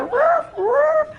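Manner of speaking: high, loud mock barking; imitated dog bark. Woman mimicking her grand dog's first bark, high barking. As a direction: bark like a small dog, two drawn-out woofs. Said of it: "she's barking. Listen to her".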